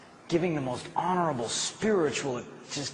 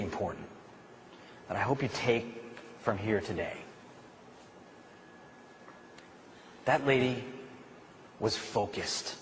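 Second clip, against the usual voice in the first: hushed and earnest; 4 semitones lower, 9 dB quieter than usual. Real, not acted. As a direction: lower and softer, drawing them in. Preacher drops to the point.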